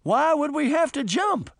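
high-pitched panicky query; alarmed question. A narrator's reading. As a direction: voice leaps up in worried disbelief. Nervous character alarmed about jumping.

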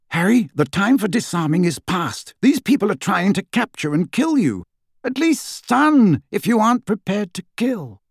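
high-pitched, exasperated urging; aghast rebuke. Acted. Lupin warning Harry that disarming is past, urgently. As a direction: drive the warning home with rising urgency.

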